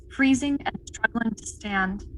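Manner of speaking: ordinary talk, nothing marked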